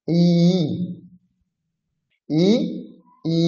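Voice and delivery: ই drawn out, held over a second; long vowel ই; long i demo. Shown as a demonstration. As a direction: stretch the ই long. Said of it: "এক সেকেন্ডের একটু বেশি".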